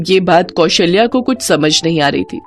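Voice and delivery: ordinary delivery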